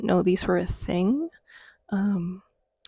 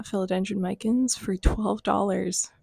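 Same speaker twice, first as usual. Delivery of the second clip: usual speaking voice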